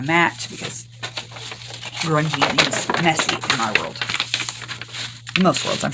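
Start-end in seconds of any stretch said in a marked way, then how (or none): none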